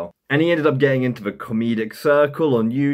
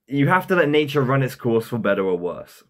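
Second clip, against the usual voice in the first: ordinary talk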